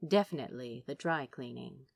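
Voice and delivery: usual speaking voice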